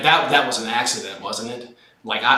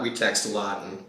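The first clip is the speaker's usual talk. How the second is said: ordinary delivery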